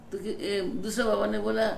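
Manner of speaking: ordinary talk, nothing marked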